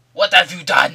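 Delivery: loud, high put-on fury; mock outraged roar. Headbutted defender rounding on his attacker, mock outraged roar. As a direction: roar it as a hammed-up outraged player. Acts headbutted player's furious reaction.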